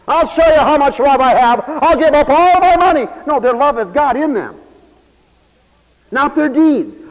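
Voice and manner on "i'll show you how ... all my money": a louder, higher showy brag; mock boastful giver. Preacher mocking a proud giver, boastful. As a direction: show off your big gift, hammed up.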